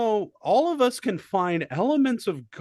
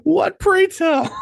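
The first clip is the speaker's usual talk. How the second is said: mock formal question; 10 semitones higher, 5 dB louder than usual. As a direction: ham up a grand pompous question.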